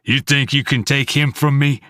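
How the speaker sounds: a hint of a furious father; angry father yell. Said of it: "Dad yells".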